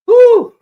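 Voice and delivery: high, loud whoop of delight; excited whoop. Real, not acted. Fan whooping over Debo's hit, high and loud. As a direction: let out a joyful woo.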